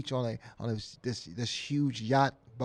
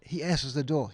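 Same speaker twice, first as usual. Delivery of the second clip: ordinary talk, nothing marked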